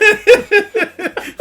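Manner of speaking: high, loud burst of laughter; hearty laughter. Real, not acted. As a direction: crack up at a friend's joke. Laughs at go-kart remark.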